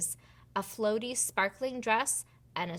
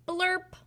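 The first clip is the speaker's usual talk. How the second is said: high squeaky wand noise; silly blurp; 10 semitones higher than usual. Acted. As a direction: pop it out like a broken wand. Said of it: "it went".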